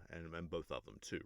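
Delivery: ordinary delivery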